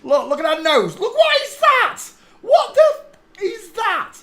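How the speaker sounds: high, loud shriek of disbelief, cracking into a laugh; shocked outburst. Real, not acted. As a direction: squeal at the sight of that nose. Shocked at a photo's nose.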